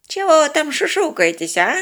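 put-on high-pitched suspicious old man; suspicious call. A narrator's reading. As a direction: call out, demanding to know. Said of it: "подозрительно окликнул дед". (подозрительный оклик старика)

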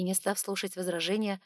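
ordinary delivery

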